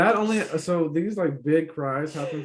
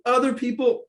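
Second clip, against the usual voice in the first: high, louder burst of feeling; emotional outburst; 10 semitones higher, 4 dB louder than usual. Retold or imitated. Listening to music that hits home: high emotional gush. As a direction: gush it out, moved by a song. Voicing own reaction to music.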